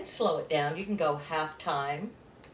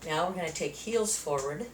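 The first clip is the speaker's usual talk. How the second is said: usual speaking voice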